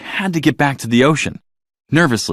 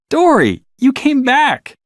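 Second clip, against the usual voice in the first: much higher and louder, delighted; excited; 4 dB louder, slower than usual. Acted. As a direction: greet a returning friend with glee. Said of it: "Nemo was excited to see Dory".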